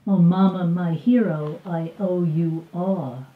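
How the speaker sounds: usual speaking voice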